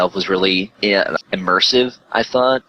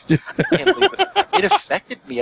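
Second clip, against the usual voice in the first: much higher, disbelieving voice; retold shock. Gamer recalling a shocking game, stunned and high. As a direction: replay the stunned reaction, pitched way up. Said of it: "I was kinda like".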